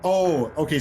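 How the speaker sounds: usual speaking voice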